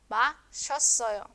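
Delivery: 마셨어요 with shi and o fused into "sho"; contracted form. Shown as a demonstration. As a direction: say the middle of 마셨어요 as one syllable. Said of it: "se hace una sola".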